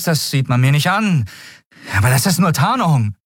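put-on a nervous boy overselling himself; boastful young Miko. Miko bragging to the captain, high and eager.

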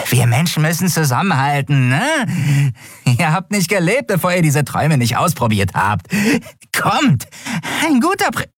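a skeletal creature sweet-talking buyers; high, creepy salesman creature; wheedling skeleton dealer. A narrator's reading. Narrator voices the skeleton dealer.

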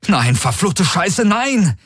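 high, drawn-out cursing in desperation; desperate cry. A narrator's reading. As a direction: curse it out, peaking on the last word. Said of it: "rief er". (verzweifelt fluchender Ausruf)

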